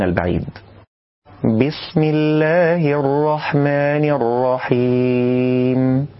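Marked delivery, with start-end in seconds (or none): 1.4-6.1 slow, drawn-out chanted recitation; tajwid recitation. Shown as a demonstration. Teacher recites the correct basmala.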